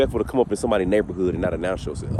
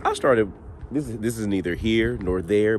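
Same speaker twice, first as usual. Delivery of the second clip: ordinary talk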